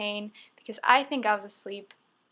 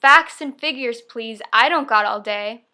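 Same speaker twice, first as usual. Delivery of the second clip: loud, emphatic quoted demand; 9 dB louder than usual. Acted. Coaching the sibling for United Airways, loud demand. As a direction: belt it out like you mean business. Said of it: "as loud as you can say".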